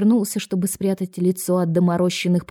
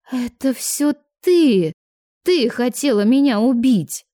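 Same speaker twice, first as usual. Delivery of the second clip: higher, slow accusing outcry; higher, louder mock-hysterical accusation, stress on ты; 5 semitones higher, 4 dB louder than usual. A narrator's reading. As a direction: point the finger, voice raised high. Accusing the waitress.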